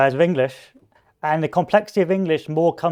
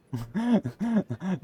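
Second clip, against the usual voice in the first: soft breathy laugh; short laugh; 6 semitones higher, 4 dB quieter than usual. Real, not acted. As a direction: laugh it off, a little sheepish. Laughs after the remark.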